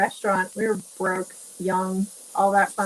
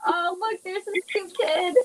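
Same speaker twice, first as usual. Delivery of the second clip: cooing lady; 9 semitones higher, slower than usual. Retold or imitated. Imitating the lady's reaction.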